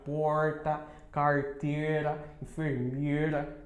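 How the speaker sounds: porta, carteira, enfermeira with a strong curled caipira R; slow rural São Paulo R; São Paulo country R. Shown as a demonstration. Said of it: "essa coisa de falar puxado".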